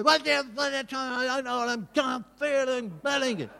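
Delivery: high, sharp reenacted snapping at his wife; angry outburst. Husband spinning round on his wife on the beach, angry outburst. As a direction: relive the instant flare of anger, pitched high. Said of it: "I ripped into her".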